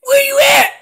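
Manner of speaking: yelled hard and high, calling across the woods; overdone shout of a lost caller; loud yell. Shown as a demonstration. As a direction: bellow it out to someone you can't see. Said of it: "You don't wanna do that".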